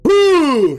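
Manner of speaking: loud, high effort grunt; explosive throwing grunt; big grunt. Acted. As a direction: heave with one big grunt. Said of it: "with one big grunt".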